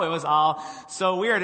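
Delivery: ordinary delivery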